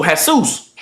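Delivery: "Jesus" said hay-SOOS, with an H; Spanish pronunciation. Shown as a demonstration. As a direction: stress the end of "Jesus", Spanish style. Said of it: "J is H".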